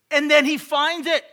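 high, very loud climax; triumphant shout. Real, not acted. Preacher reaches the moment the leak is found, triumphant shout. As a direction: belt out the big discovery.

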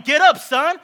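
high, loud mock command with a laugh; hammed-up shout. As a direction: bellow it over the top as Jesus. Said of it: "He probably didn't say it like that".

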